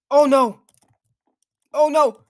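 panicked loud oh no; alarmed shout. Real, not acted. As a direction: yell it as the monster catches you. Bot catches him, cries out.

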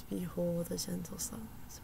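softly whispered quote; quiet whisper. Retold or imitated. Quoting the writer's gentle line in a whisper. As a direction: whisper it like a hushed line of poetry. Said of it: "she says quietly".

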